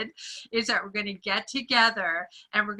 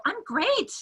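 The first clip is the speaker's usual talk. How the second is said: bright high quote; 11 semitones higher, 3 dB quieter than usual. Retold or imitated. Retold chat with shop woman.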